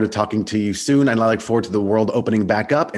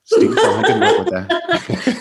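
laughing; 4 dB louder than usual. Real, not acted. Host laughing at an answer.

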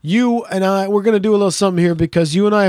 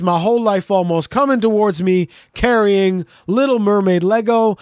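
ordinary talk

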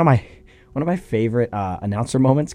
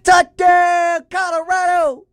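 high-pitched, belted touchdown call; excited announcer call; slower than usual. Retold or imitated. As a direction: imitate an announcer screaming for Colorado. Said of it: "anytime Colorado's going".

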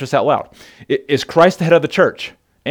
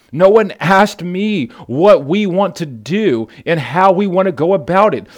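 forceful preaching; 3 semitones higher than usual. Real, not acted. Preacher to the men, insistent forceful preaching. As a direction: press the point home from the pulpit.

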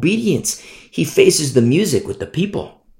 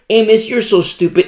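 higher, louder sneer at Amos; mocking scoffer; 7 semitones higher, 3 dB louder than usual. A scoffing neighbour sneering at the prophet, mocking. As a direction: scoff like a know-it-all neighbour.

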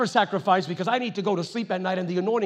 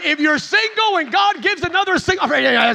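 excited preaching; 7 semitones higher, 9 dB louder than usual. Real, not acted. Preacher's joke to singles.

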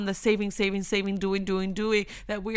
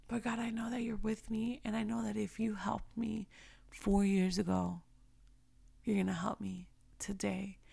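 soft, half-whispered prayer; quiet plea to God; hushed prayer; 9 dB quieter than usual. Acted. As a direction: drop to a near whisper, trusting and intimate. Acts out her own prayer.

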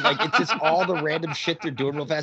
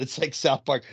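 ordinary delivery

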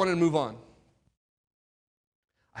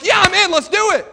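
much higher and louder, keen agreement; eager yes; 13 semitones higher, 8 dB louder than usual. Retold or imitated. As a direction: jump at the offer. Eager yes to every request.

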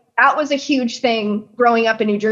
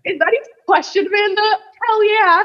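higher, faster, playful mock outrage at the question; mock eager disbelief; 10 semitones higher, faster than usual. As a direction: act like it's the dumbest question ever, then cheer. Retold eager reply to Amanda.

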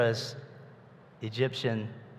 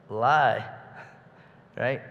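ordinary talk, nothing marked

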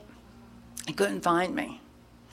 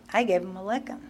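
ordinary talk

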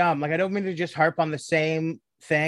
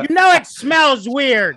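exasperated; 8 semitones higher, 6 dB louder than usual. Real, not acted. Mock-exasperated answer to Gary.

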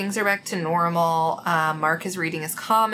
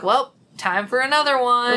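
louder, higher mock sign-off; breezy sign-off; 6 semitones higher, 5 dB louder than usual. Host imitates old shows' abrupt endings, mock cheery sign-off. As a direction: toss it out like a show wrapping up.

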